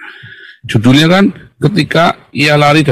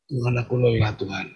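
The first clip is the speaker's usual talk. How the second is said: hushed, much softer plea; weary prayer; 12 dB quieter than usual. Acted. Tired believer confessing weariness to God, hushed weary prayer. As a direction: pray it softly, worn out. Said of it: "Kalau kita memang lagi capek".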